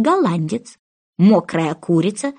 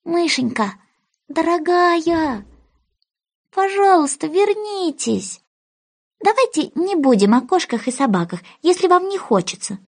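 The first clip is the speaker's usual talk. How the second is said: Alice calling the mouse back tenderly; coaxing tender voice; 7 semitones higher than usual. Acted. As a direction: coax the mouse back gently. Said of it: "позвала её ласковым голоском".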